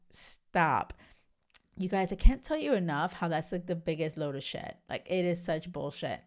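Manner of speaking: ordinary delivery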